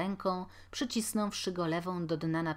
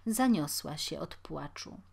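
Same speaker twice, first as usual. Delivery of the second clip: plain reading voice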